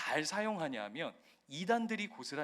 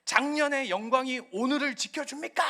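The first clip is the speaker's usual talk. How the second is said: louder, higher indignant challenge; angry locker-room rant; 6 semitones higher, 6 dB louder than usual. Retold or imitated. Coach Hong in the Ulsan locker room, imitated fury. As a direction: shame the team with a furious question. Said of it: "막 분노하는".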